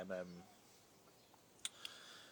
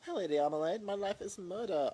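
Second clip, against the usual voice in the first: much louder, higher theatrical delivery; hammed-up show line; 9 semitones higher, 9 dB louder than usual. Announcing her TV episode to viewers, loud and theatrical. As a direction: belt it like a dramatic TV line.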